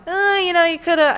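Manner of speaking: high-pitched, reluctant imagined note; hesitant mock critique. Imagining feedback on a flawed scene, high and hesitant.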